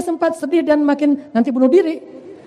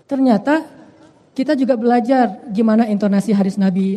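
ordinary talk

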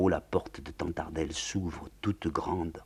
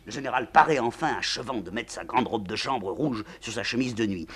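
ordinary delivery, nothing marked

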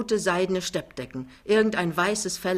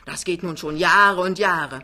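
louder, exasperated mother; angry, swelling complaint; furious; 7 dB louder than usual. A narrator's reading. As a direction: hammer out the old grievance. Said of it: "sagt Frau Pinneberg Senior wütend".

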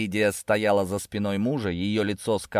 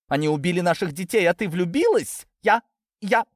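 high, incredulous outburst; outraged; 10 semitones higher, 3 dB louder than usual. A narrator's reading. Lidia rebuking Natasha for falling in love, outraged. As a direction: accuse her, climbing higher into spluttering. Mother's outrage in family quarrel.

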